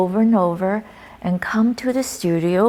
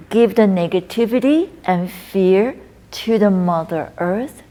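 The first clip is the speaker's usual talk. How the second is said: recited qigong poem; 6 dB louder than usual. Acted. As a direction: intone each line as a healing verse.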